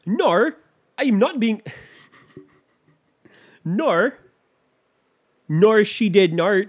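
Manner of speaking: hammed-up British indignation with laughs; bad British accent. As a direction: attempt a posh British reply and fail. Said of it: "I can't get the British thing down".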